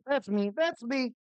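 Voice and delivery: loud hyped shout. Real, not acted. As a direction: belt it out proudly. Intro catchphrase shout.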